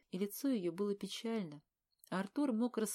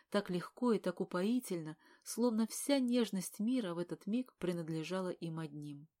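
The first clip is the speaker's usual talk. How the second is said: usual speaking voice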